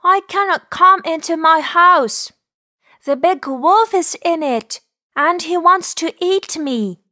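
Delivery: high, plaintive rabbit voice; pleading little rabbit. Acted. Rabbit tells horse its trouble.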